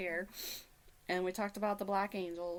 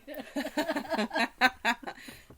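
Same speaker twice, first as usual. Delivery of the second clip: laughing; 6 semitones higher, 6 dB louder than usual. Real, not acted. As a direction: burst of laughter. Laughing at own joke.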